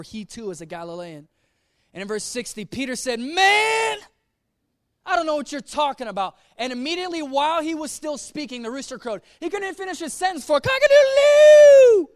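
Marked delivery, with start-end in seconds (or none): 3.2-6.4 high, loud exasperated shout; angry denial. Acted. Peter cornered, denying angrily. 9.4-12.1 very high, loud crowing rooster voice; preacher crowing like the rooster mid-sentence; rooster crowing imitation. Rooster interrupts Peter's sentence.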